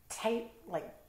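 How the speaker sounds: ordinary delivery, nothing marked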